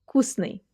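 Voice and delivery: вкусный starting with f instead of v; вкусный with a soundless f running into the k; voiceless first consonant. Shown as a demonstration. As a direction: say вкусный as FKUS-niy. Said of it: "pronounced as their voiceless twins".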